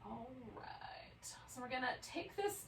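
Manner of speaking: unvoiced, hushed whisper; quiet whispered cue to the class. Real, not acted. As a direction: whisper the next step gently. Soft transition cue in class.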